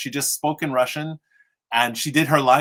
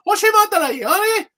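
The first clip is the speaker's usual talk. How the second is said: much higher, louder fake Japanese shout; 8 dB louder than usual. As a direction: blurt out made-up Japanese. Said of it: "I can do fake Japanese".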